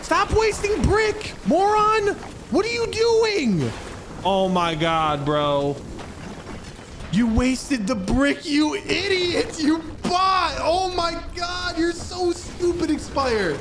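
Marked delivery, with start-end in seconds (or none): 0.0-3.7 much higher, slow exasperated yelling; frustrated yell. Real, not acted. Yelling at teammate's mistake. 7.1-12.9 exasperated shout. Real, not acted. Yelling at teammate's mistake.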